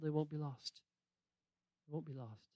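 soft fading repeat. Shown as a demonstration. Softening repeat.

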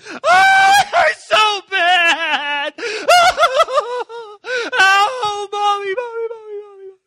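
imitating a bawling kid, laughing through it; mock screaming wail. Mom mocking her crashed five-year-old with a laughing, hammed-up wail. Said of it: "on the floor screaming".

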